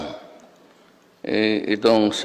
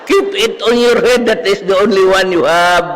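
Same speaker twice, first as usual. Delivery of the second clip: shrill mocking professor; 11 semitones higher, 6 dB louder than usual. Professor berating a student for scratching his head, shrill high tirade. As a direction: scold the dandruff scratcher in a screeching teacher voice. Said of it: "boses, ano, siya, shrill".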